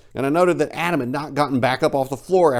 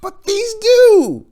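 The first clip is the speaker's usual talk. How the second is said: high-pitched, rising eager speech; excited; 10 semitones higher than usual. Acted. Cyril explaining what the family taught him, excited. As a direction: build it up with eager wonder. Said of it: "he finished excitedly".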